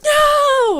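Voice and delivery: very high drawn-out wail; mock horrified cry. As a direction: imitate a group crying out in horror. Everyone reacts to Jill falling.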